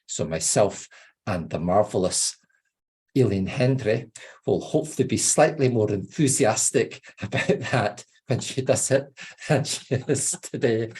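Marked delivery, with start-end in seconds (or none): none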